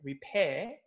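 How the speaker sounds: "repair" with a silent British R; British accent. Shown as a demonstration. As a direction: end "repair" on an open British "peh". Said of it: "they don't, they don't really".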